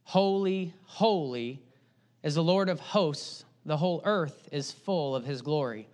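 lightly voiced heavenly cry of worship; seraphim crying out. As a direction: reverent proclamation, understated. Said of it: "the seraphims cry out".

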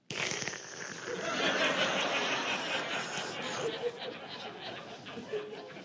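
airy, high laugh that fades; high breathless laugh. Real, not acted. Pastor cracking up at his own story. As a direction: let the laugh run out of breath.

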